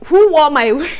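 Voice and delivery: loud high exasperated cry; who wore my wig, shouted high; indignant shout. Real, not acted. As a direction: demand it in disbelief. Outraged at slack wig.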